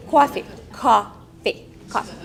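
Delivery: repeating coffee with a broad Long Island vowel; Long Island accent. Retold or imitated. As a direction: chew on coffee in a thick Long Island accent, each take falling. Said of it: "aware of my Long Island accent".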